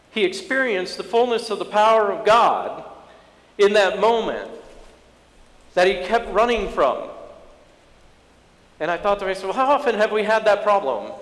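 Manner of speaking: high, insistent sermon voice; impassioned preaching. Acted. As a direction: press the point home to the congregation. Preacher builds to the sermon's point.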